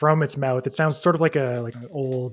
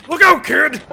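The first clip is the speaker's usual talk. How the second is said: higher, loud panicked warning yell; warning shout; 10 dB louder than usual. Acted. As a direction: yell out to the kid in the street. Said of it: "the voice say".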